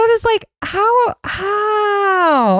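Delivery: high, cracking cry of disbelief; incredulous high-pitched outburst; exasperated disbelief. Real, not acted. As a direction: throw up your hands and shout how. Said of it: "exasperated disbelief".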